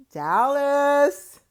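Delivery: Dallas called out high and loud with a rise; Dallas shout-out. Shown as a demonstration. Calling out to fans in Dallas, loud and high. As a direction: hype the city name up high. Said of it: "I will be in Dallas".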